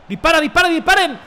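high, loud, frantic urging; urgent shout. Real, not acted. Gamer to teammates near goal, urgent shouting. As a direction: yell at them to shoot.

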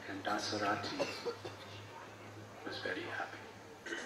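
soft whispered ending; hushed whisper. Real, not acted. Preacher closing the story softly, in a whisper. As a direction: drop to a whisper to savour it.

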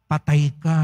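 low, heavy "patay ka"; ominous punchline. Acted. Priest warns shoppers at the checkout, low ominous punchline.

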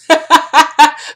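bright high laugh; a delighted burst of laughter; happy laugh. Real, not acted. Laughs at her billboard.

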